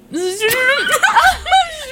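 high shrieking burst of laughter. Real, not acted. Laughing shriek after swearing.

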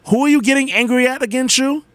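high-pitched challenge to oneself; scolding himself. Acted. Inner voice confronting the annoyed rider, scolding. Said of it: "a thought crept into my head".

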